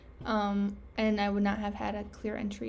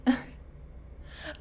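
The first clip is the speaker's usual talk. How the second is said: embarrassed laugh; 3 semitones higher, 3 dB quieter than usual. Real, not acted. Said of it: "I'm so embarrassed".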